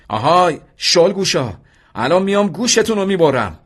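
high, loud yelling threat; threatening shout. A narrator's reading. Vahid shouting threats at the Iraqi trench, loud high yell. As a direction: scream it at the enemy trench. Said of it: "فریاد کشید".

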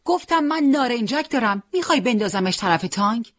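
high and loud insistent call; shouting. Acted. Boy repeats his offer of a grenade, shouting. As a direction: yell it across again. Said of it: "دوباره داد کشید".

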